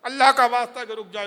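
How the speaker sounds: calling out loudly to stop them, peaking into a shout; a desperate cry from outside the door to halt them. Acted. Rabi calls through the door to stop the girls eating, loud shout. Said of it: "زور سے آواز لگائی".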